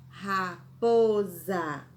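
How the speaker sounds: Raposa drawn out slowly, za sounded like z; slow dictation. Shown as a demonstration. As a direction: dictate it syllable by syllable. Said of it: "pensamos que é escrito com Z".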